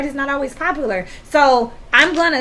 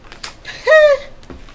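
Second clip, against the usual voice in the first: high burst of laughter; 4 dB louder than usual. Real, not acted.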